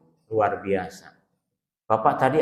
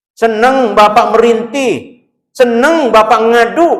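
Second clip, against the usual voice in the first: higher, louder fervent exhortation; preaching at full force; 7 semitones higher, 5 dB louder than usual. Acted. Preacher urges the congregation to keep faith in prayer, fervent preaching. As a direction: drive the point home to the congregation.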